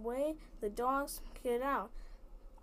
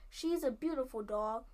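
ordinary delivery

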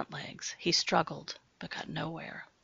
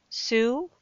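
raised call, cautious; 5 semitones higher, 5 dB louder than usual. Acted. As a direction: call her name warily. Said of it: "he called out while approaching".

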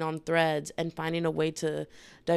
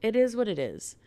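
higher-pitched quick shrug, falling away; resigned self-quote; 5 semitones higher than usual. Retold or imitated. Podcaster recalling what she told herself, resigned. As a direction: toss it off as a verdict on yourself. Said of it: "I just sat here and I went".

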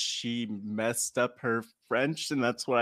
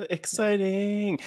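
drawn-out excitement; 7 semitones higher, much slower than usual. Real, not acted. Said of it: "slow excited word".

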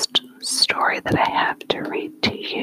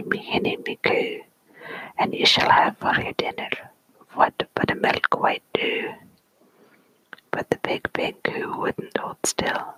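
story poem whispered in a put-on Scottish accent. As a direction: whisper the tale with a playful Scots accent. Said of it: "read it with the proper accent".

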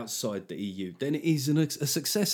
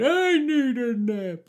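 put-on a much higher, slow, creaky old-man whine; mock a doddering old cartoon grandpa asking for a nap; Grandpa Simpson; 12 semitones higher, 6 dB louder, much slower than usual. A comedian mocking an old president as Grandpa Simpson, very high and slow. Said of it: "Grandpa Simpson there going".

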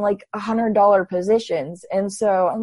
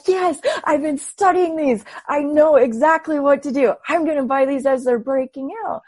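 excited; 6 semitones higher than usual. Retold or imitated. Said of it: "so I was really excited".

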